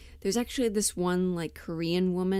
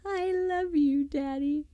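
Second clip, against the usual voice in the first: put-on much higher, sugary little girl voice; sweet girlish love; 8 semitones higher, 3 dB louder, slower than usual. Daughter telling her father she loves him, high and sweet. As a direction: coo it like a doting daughter. Acted scene from old show.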